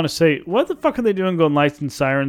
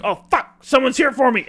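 higher, panicky junkie voice; panicked tweaker; 9 semitones higher than usual. Retold or imitated. Imitating a tweaker spotting the cops, higher and panicky. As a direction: play the spooked tweaker. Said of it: "he goes".

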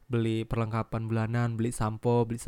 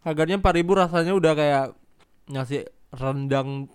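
excited; 3 semitones higher, 5 dB louder than usual. Real, not acted. Excited about cheap cake.

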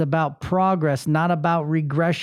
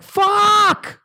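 high, loud drawn-out yell; sudden high-pitched outburst of exasperation; frustrated yell; 13 semitones higher, 5 dB louder than usual. Acted. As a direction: let the frustration explode in one long shout. Acts out frustrated outburst at life.

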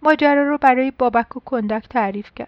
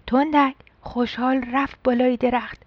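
usual speaking voice

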